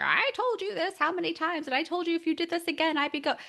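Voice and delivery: high, fast scolding lecture; lecturing parent. Retold or imitated. Mother retelling how she lectured her son, high scolding. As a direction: rattle off the old nagging lecture. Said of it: "I would lecture".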